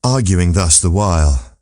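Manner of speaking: usual speaking voice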